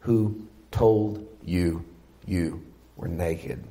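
slow, soft, low and spaced out; hushed, measured question with long gaps; gentle corrective question. Acted. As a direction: ask it quietly, word by word. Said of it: "No, he says".

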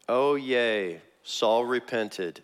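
slow, unimpressed fake cheer; sarcastic mock relief. Teacher doubting Saul's repentance, sarcastic slow cheer. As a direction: drag it out, not buying it.

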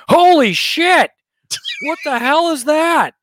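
high, loud panicked yell; shocked shriek. Pretend. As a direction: scream it in sudden shock. Passenger sees burning engine.

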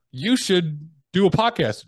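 hammed-up mentor barking tasks; mock bossy mentor. Mentor pushing him to say yes, mock bossy voice.

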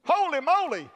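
usual speaking voice

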